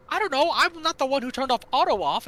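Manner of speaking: defensive retort. Retold or imitated. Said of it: "I was like".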